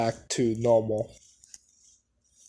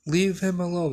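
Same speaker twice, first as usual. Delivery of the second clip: brash; 6 semitones higher than usual. Retold or imitated. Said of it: "kinda becomes more brash".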